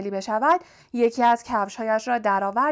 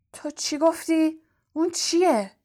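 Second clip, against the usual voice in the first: mildly angry, raised-pitch demand; 6 semitones higher than usual. A narrator's reading. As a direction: question the elf with restrained anger, pitched up. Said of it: "آقای مالفوی با عصبانیت گفت".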